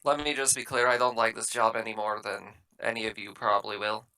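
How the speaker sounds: normal talking voice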